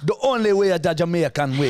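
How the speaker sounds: put-on Jamaican accent. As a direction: play up a sing-song Jamaican accent. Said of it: "You went a little Jamaican".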